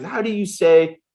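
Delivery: mock the boss's German accent as he gropes for English. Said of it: "play around with this German accent".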